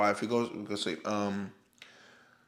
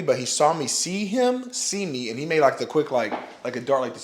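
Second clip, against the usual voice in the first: ordinary talk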